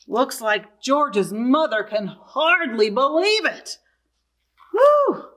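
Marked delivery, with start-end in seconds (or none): 4.7-5.3 high whoop of delight; a sudden delighted woo; excited high whoop for the kids; cheer. Acted. Reader cheers the cow.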